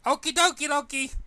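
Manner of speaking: very high sing-song chirp; bubbly agreement. Acted. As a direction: chirp it like a giddy cartoon girl. Pinkie cheerfully agrees.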